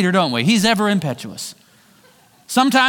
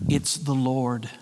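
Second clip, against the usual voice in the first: hushed awe; 7 semitones lower, 7 dB quieter, slower than usual. Retold or imitated. Disciple quietly recognizes Jesus.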